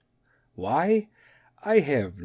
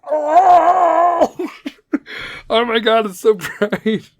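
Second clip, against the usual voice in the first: much higher, louder wail breaking into laughter, slowing at the end; mock anguished shout; 11 semitones higher, 7 dB louder, much slower than usual. The woken mummy howling at the light, in a mock shriek that breaks into laughs. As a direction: wail it like light burns your eyes, laughing through it. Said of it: "and be like".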